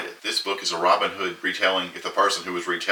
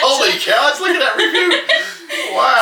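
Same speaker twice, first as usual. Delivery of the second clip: laughing; 6 dB louder than usual. Real, not acted. Reacting to a review, laughing.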